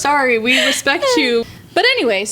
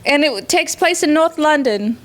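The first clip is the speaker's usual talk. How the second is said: higher-pitched, hammed-up British accent; bad British accent; 4 semitones higher than usual. Host noting the book's North London setting, bad British accent. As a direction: put on your worst British accent. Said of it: "this is my worst British accent".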